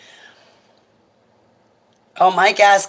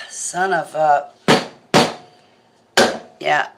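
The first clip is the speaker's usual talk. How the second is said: ordinary delivery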